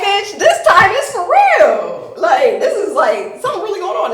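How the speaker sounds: high, loud acted protest; exasperated yelling. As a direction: insist urgently at the operator. Protests being put on hold.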